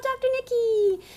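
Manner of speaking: ordinary talk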